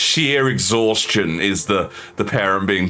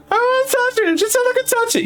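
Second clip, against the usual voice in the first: put-on whiny kid; faster than usual.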